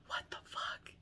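whispered disbelief; breath-only reaction of shock; stunned whisper. Real, not acted. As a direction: drop to a voiceless, astonished whisper. Stunned after hearing old voice.

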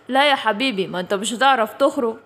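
low, teasing chuckle then a knowing warning; sly old guardian chuckle. Acted. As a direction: tease the lost kids from deep down. Figure teases the lost kids. (ضحكة عجوز ماكرة بصوت واطي)

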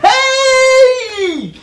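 high startled "Hey!"; sudden outcry. Real, not acted. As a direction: burst out in dismay. Sudden outcry about priests.

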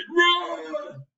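high shouted command. Acted. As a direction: bark it as an urgent warning. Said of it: "Jack up here said".

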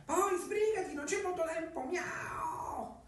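high, slow purring cat voice. Acted. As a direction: speak as a sly talking cat. Cat hurries her.

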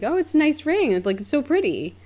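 bright social compliment; polite admiration. Retold or imitated. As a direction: praise it sweetly, starting way up high. Retells her polite praise.

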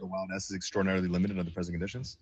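rattled off fast; fast tongue twister. Shown as a demonstration. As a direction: race through the tongue twister. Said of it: "Do you know the one that goes".

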